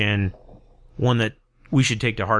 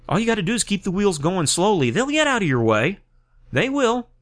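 emphatic; 9 semitones higher than usual. Real, not acted. Truck through protesters.

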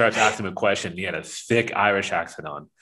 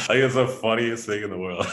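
laughing; 2 semitones higher than usual. Real, not acted. Still laughing after joke.

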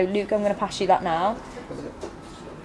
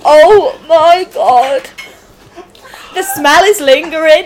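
loud groan; 4 semitones higher, 9 dB louder, slower than usual. Real, not acted. Reacting to a foul smell.

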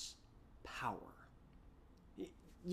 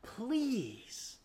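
slow, higher desperate plea; pleading; 6 semitones higher, 4 dB louder, much slower than usual. Acted. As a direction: beg him with a slightly raised voice. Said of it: "He pleads with him".

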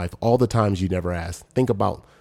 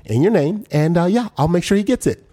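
higher, louder, sing-song brush-off; fake-polite receptionist; 8 semitones higher, 5 dB louder than usual. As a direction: politely humour someone you want gone. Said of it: "That was really just, Please leave this place".

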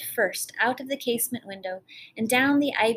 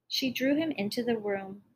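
plain reading voice

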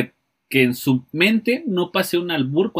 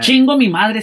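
louder and higher, full of resolve; determined; 8 semitones higher, 7 dB louder than usual. Shown as a demonstration. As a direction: say it like you'll get it done no matter what. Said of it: "Determinación, papá". (con determinación, más fuerte y agudo)

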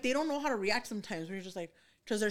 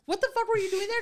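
high, louder incredulous question; mock shock at the brother, pitched up; retold disbelief; 11 semitones higher, 7 dB louder, faster than usual.

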